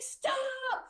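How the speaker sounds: high, held-back reenacted yell; mock yell. Foster mom to the driver passing her house, high mock yell. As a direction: call out high without real volume.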